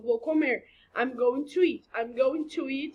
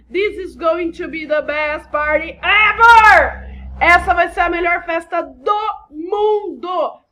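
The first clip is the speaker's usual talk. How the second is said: loud, high, stretched-out party hype; over-the-top meme exclamation; hyped-up shout; 5 semitones higher, 12 dB louder, slower than usual. Acted. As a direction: belt it out slowly with exaggerated excitement. Performs the meme sentence and translation.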